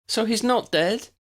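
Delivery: put-on high, hopeful young woman's question; a hopeful girl asking if he lives. A narrator's reading. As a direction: let hope lift the question. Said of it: "Lydia sounded hopeful".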